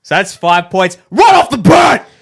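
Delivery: loud triumphant cheer; excited shout. Real, not acted. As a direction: crow about the win. Celebrating winning points.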